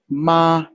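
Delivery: "ma" held high and flat; sung, high level "ma"; first tone. Shown as a demonstration. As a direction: hold "ma" on one high level note. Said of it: "high and flat".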